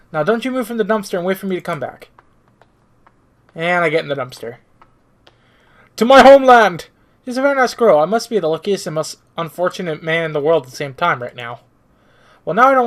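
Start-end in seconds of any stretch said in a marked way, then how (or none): 6.0-6.9 higher, much louder cry; triumphant shout. Acted. Hero shouts off toward his homeland, triumphant and loud. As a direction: hurl it out like a battle cry.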